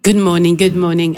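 ordinary talk, nothing marked

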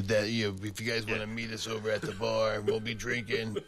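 imitating a crackly radio smoker; crackly bar-promo radio voice; scraggly smoker voice. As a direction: put on a scraggly voice from too many cigarettes. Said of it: "scraggly smoker voices".

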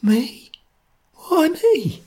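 a faint, high frightened question. A narrator's reading. Sotcher faintly asks why he must do it, high. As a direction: ask it faintly in disbelief. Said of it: "asked faintly".

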